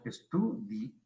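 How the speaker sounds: ESTUDI- broken off after a stressed DI; wrong split. Shown as a demonstration. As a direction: stress DI in ESTUDI- as if a hiatus followed. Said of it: "No decimos estudi".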